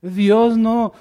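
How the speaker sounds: ordinary talk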